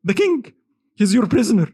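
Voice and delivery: high, pleading wife of the king; a queen asks Salah ad-Din to free the king, high and pleading. Retold or imitated. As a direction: beg for her husband's release. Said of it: "came to him and said".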